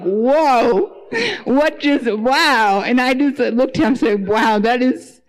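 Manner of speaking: put-on stunned and moved by a child's wisdom; mother amazed at her son's reply.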